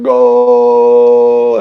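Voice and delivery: loud, long-held announcer shout; Spanish broadcast goal call. Acted. Streamer imitates a Spanish announcer after a score, held shout. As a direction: hold the word out loudly. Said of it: "holds it for, like, forty-five seconds".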